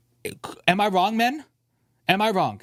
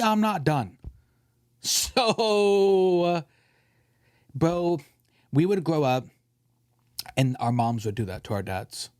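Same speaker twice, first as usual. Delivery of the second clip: normal talking voice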